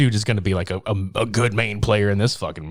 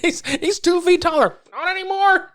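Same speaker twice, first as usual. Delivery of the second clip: high mock dad then offhand quip. Host re-enacting the dad and the heckler, high and playful. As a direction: replay it, high and amused. Retelling the joke line again.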